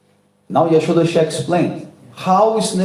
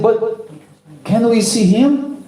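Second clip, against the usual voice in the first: put-on high, wondering child's voice; a little boy asking in wonder; child Krishna; 9 semitones higher than usual. A narrator's reading. Krishna asks his mother about God, high and wondering.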